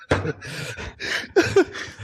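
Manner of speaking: high breathy laugh. Real, not acted. Laugh after goblin voice.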